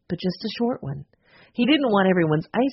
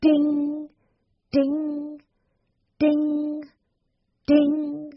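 high sung ding of a clock bell; clock chime; 10 semitones higher, much slower than usual. A narrator's reading. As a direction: chime it like an old clock striking. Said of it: "the old clock chiming".

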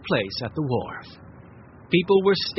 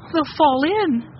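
put-on high, louder alarmed cry of a girl; worried cry; 12 semitones higher, 5 dB louder, slower than usual. A narrator's reading. Violet watching children on a boat deck, alarmed cry. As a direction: gasp it out in alarm. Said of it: "cried Violet".

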